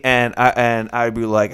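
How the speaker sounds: ordinary talk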